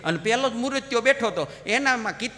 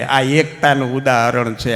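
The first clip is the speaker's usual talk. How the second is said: normal talking voice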